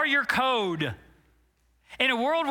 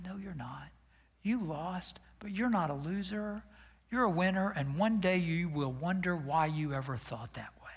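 soft whisper, much softer, steady and firm; calm, emphatic father; 3 semitones lower, 7 dB quieter than usual. Retold or imitated. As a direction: quietly reassure a crying son. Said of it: "my dad calmly but emphatically said".

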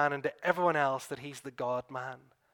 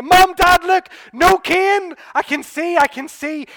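much higher, much louder joyful yelling; overjoyed shout; 13 semitones higher, 16 dB louder than usual. Pretend. Healed son bursting in on his parents, ecstatic shout. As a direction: burst in shouting the news, beside yourself with joy. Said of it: "imagine him bursting through the door".

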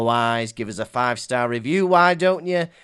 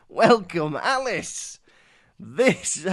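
ordinary talk, nothing marked

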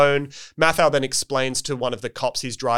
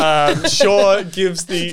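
laughing; 6 semitones higher, 6 dB louder, much slower than usual. Real, not acted. Cracking up mid-sentence.

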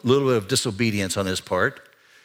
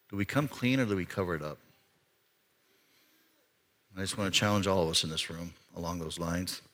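quiet challenge; 6 semitones lower, 10 dB quieter, faster than usual. Real, not acted. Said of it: "solemn challenge to congregation".

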